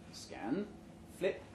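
ordinary delivery

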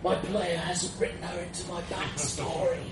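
hammed-up deathbed confession; dying, short of breath. A narrator's reading. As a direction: push the words out on failing breath. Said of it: "I gasped for another breath".

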